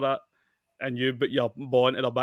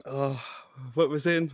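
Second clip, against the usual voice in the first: usual speaking voice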